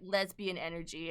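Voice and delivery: ordinary talk, nothing marked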